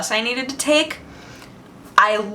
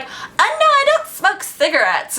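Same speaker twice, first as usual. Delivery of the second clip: put-on high, airy girl's voice, much higher than her own; 11 semitones higher than usual. Girl on the bus turning down the dealer, voiced high. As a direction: play the naive girl, pitched way up. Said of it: "she's like".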